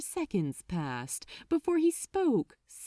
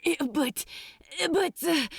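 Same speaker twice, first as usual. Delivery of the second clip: higher, stumbling panic; panicked stammer; 8 semitones higher than usual. Acted. Crowley stammering in panic at the order, high. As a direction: stammer in horror. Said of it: "Crowley stumbled for coherency in his panic".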